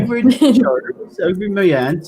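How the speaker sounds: put-on high, loud West Country accent. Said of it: "I'm bringing out my West Country accent there".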